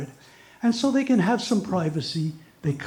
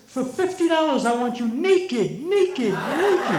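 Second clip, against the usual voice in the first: demanding parrot; 7 semitones higher, slower than usual. Acted. Male parrot demanding his money's worth, high squawk. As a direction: squawk naked insistently.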